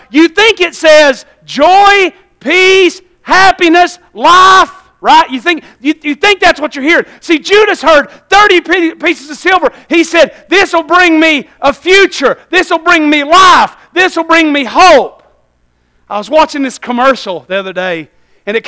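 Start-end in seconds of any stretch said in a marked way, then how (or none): 0.1-5.3 high, drawn-out preaching cry; forceful preaching. Acted. Preacher warning the church about mishearing the devil, forceful preaching. 7.2-15.2 high, emphatic pulpit delivery; forceful preaching. Acted. Preacher voicing Judas's false hope in thirty pieces, forceful preaching.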